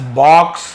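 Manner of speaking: teacher modelling aw in "बॉक्स"; right pronunciation. Shown as a demonstration. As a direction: punch "बॉक्स" with a rounded aw. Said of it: "बॉक्स नहीं".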